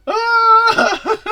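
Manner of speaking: high startled yelp breaking into laughter; happy shock. Retold or imitated. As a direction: squeal with delighted shock. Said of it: "like, happy shock sort of thing".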